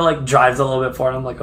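usual speaking voice

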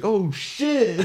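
high-pitched mock alarm; hammed-up squeal of shock. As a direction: imitate startled friends reacting. Acting friends' shock at coughing.

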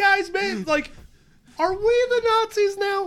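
very high-pitched put-on questioning; high voice, appealing to comrades; mock self-doubt. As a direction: pitch it up in mock dawning realization. Mock doubt about being bad guys.

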